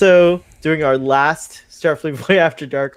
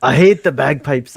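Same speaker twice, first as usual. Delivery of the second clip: a louder, cross mock Scottish voice; angry Scottish accent; 5 dB louder than usual. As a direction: grumble hate in an angry Scots voice. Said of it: "I don't know why it's angry".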